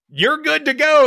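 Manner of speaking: high, loud cheery all clear; mock chief approval. Chief waving the pilot off to fly, high and bright. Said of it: "he goes like this, meaning".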